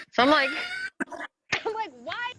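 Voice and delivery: high laughing disbelief. Real, not acted. Mom retelling the spanking story, laughing. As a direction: laugh at your own absurdity.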